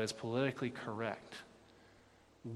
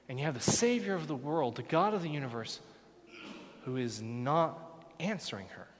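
usual speaking voice

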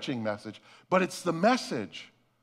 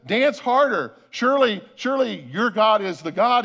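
loud, raised-pitch goading; taunting smack talk; 4 semitones higher, 5 dB louder than usual. Acted. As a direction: jeer at their god with mock certainty. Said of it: "He starts talking some smack".

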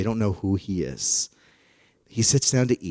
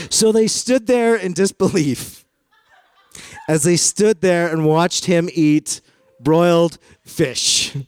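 mock-dramatic; 7 semitones higher, 4 dB louder, slower than usual. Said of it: "comic retelling".

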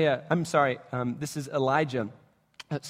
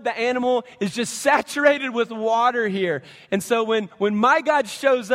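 higher, louder, building command; rising preaching; 5 semitones higher, 4 dB louder than usual. Acted. Elijah ordering the water poured, building and raised. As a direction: climb toward the miracle.

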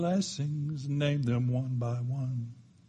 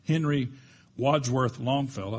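ordinary delivery

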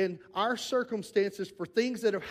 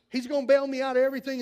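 louder, faster and higher repeat; eager believer, sped up; 5 semitones higher, 6 dB louder, faster than usual. Retold or imitated. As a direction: rush it out, sure God will rescue. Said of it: "they expect".

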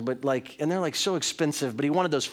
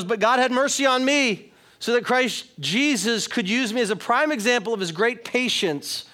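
excited, higher-pitched reading of scripture; pumped-up scripture reading; 4 semitones higher than usual. Acted. Preacher reading Paul's letter to the congregation, pumped and emphatic. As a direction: read it out pumped, like the writer felt it. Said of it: "He's pumped".

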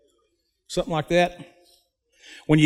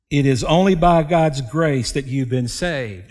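normal talking voice

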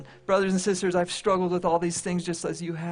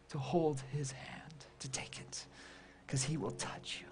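hushed tender; 3 semitones lower, 14 dB quieter than usual. Real, not acted. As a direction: draw them in gently. Gentle invitation to the hurting.

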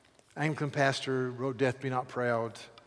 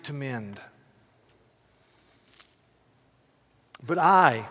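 poem reading; 5 dB louder than usual. Acted. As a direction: read the sonnet with weight. Reads John Donne poem.

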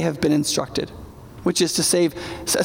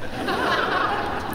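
high laugh; 11 semitones higher, 5 dB quieter than usual. Real, not acted. Laugh at own joke.

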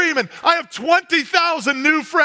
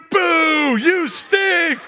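mock booing yell; 5 semitones higher, much slower than usual. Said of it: "screaming my name to".

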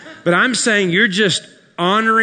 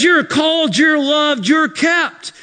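loud, high proclamation of Jude's words; shouted declaration; 12 semitones higher, 6 dB louder, slower than usual. Acted. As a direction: shout it out like a promise. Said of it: "Jude says".

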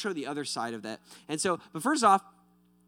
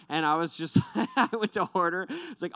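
usual speaking voice

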